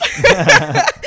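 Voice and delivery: high, loud burst of laughter; real laughter at her husband's joke. Real, not acted. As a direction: let a big laugh break out.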